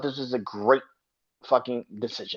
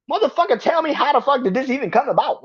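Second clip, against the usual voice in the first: incredulous rant; 11 semitones higher, 4 dB louder than usual. Real, not acted. Ranting disbelief at wrestling booking.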